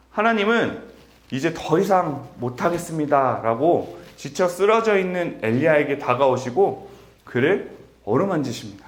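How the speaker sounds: normal talking voice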